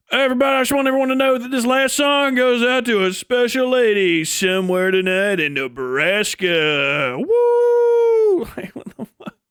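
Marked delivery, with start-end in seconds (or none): 0.1-7.2 hammed-up stage announcer voice, fast then slowing; mock concert singer. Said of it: "mock singer dedicating a song". 7.2-8.5 high hammed-up woo; mock cheer.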